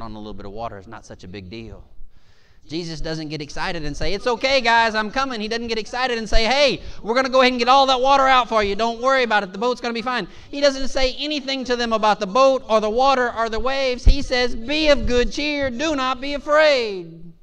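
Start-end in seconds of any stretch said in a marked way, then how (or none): 2.7-14.6 high, loud, driving sermon delivery; impassioned preaching. Real, not acted. Preaches at full force. 14.6-17.3 high, loud, slow sermon climax; proclaimed command. Retold or imitated. Climax of the preached story.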